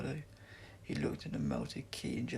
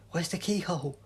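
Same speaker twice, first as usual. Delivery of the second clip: loud, higher urgent question; cried; 6 semitones higher, 7 dB louder than usual. A narrator's reading. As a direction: cry out in a hurry. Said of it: "she cried".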